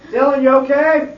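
loud high call down the dark hall. Real, not acted. Calling to a boy down hall.

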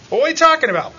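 normal talking voice

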